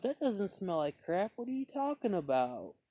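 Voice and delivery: a hint of an incredulous defender; defending guy. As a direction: push back like an amused stranger. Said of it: "he goes".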